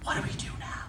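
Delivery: hushed whispered panic. Retold or imitated. The stunned store whispering in panic.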